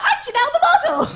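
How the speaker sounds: very high, loud, fast squeal; hammed-up squealed announcement; model's blurted introduction. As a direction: shout it out eagerly as the model. Model blurts over her.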